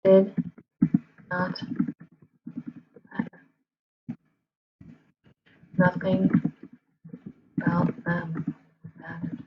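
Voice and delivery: word by word, long pauses between; halting, piecemeal delivery while typing; typing aloud. Real, not acted. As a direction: dictate slowly to yourself as you type. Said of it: "I'm typing like".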